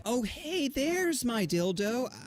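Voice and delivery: high, slow phony delight at the dildo; fake innocent surprise. Student to principal, high slow mock surprise. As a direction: brazenly feign finding a lost thing. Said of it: "I still said".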